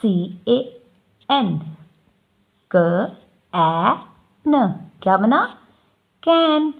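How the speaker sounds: "CAN, KN" drawn out very slowly; slow sounding out. Shown as a demonstration. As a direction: sound each letter out long for a child. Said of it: "जैसे".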